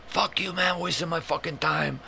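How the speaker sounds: high-pitched mock Jamaican rage; irate cussing. Scammer cussing at the prankster, mock irate. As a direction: cuss him out in a high voice. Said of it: "he started fucking cussing at me".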